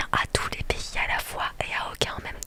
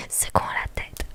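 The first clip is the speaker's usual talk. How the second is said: ordinary talk, nothing marked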